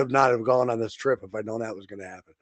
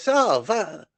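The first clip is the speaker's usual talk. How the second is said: put-on high, stiff German accent; German captain accent; 11 semitones higher, 3 dB louder than usual. German captain quizzing guests at his table, high stiff German accent. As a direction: play the German captain holding court. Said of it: "I remember his accent".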